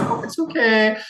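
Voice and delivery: high, soothing mother voice; comforting Mama. Acted. Mother soothes the crying boy, light high comfort. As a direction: reassure the boy, pitched high. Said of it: "Mama Fjarlan's like".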